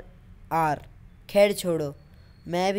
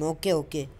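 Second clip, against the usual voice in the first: ordinary talk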